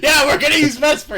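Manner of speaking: high, loud laughing; teasing plug, cracking up. Real, not acted. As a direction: laugh through the joke.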